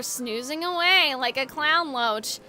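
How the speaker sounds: lazy low delivery, stretching the words; Floyd. Acted. Floyd describing the sleeping manager, low and languid. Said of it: "what voice did I do for Floyd?".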